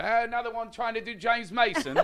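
high, loud laughing English voice; imitating a dismissive English woman; mock English accent. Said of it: "I go".

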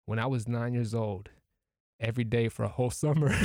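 ordinary talk

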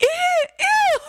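very high repeated squeals; imitated high-pitched wail. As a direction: mimic a screaming kid in short squeals. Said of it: "a high-pitched thing".

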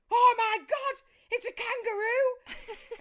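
very high, loud, slow laughing shriek; children spotting a kangaroo in the woods, shrieking; astonished kids. Retold or imitated. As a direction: shriek in disbelief at the sight. Kids' astonished discovery.

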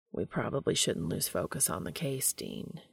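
flat. Acted. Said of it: "Castiel says flatly".

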